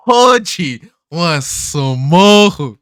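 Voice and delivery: loud, drawn-out hype; hyped up. Real, not acted. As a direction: sell it like a fight promo. Hyping favourite character.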